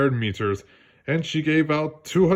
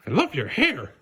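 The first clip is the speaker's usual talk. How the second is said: tiny shrew voice; 5 semitones higher than usual. Acted. Shrew compliments.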